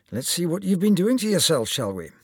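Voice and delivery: high-pitched, brisk-rising Scottish surgeon; Scottish accent. Acted. Surgeon starting to examine his patient, Scottish accent. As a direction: open the consultation in a Scottish lilt. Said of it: "with just a hint of a Scottish accent".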